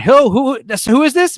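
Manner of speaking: confused caller. Retold or imitated. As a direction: play someone puzzled by a stranger's text. Acting out a confused phone call.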